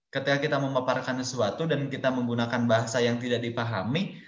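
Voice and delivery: usual speaking voice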